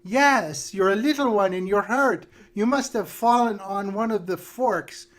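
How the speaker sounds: put-on sugary old witch comforting a child; sweet witch voice.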